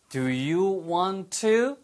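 very slow, every word separate, rising end; unhurried teacher's model with 'want to' kept apart; slow, full form. Shown as a demonstration. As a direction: draw out each word of 'Do you want to'. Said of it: "빨리 하면".

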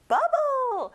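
"bubble" sung out high and bright; playful high word. Shown as a demonstration. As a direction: pop the word up high for kids. Teacher presenting fun word.

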